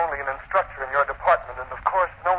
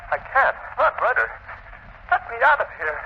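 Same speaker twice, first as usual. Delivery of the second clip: panicked pleading; 12 semitones higher than usual. Acted. Assistant trapped by Anton at the door, frantic panic. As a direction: beg to be let out in terror.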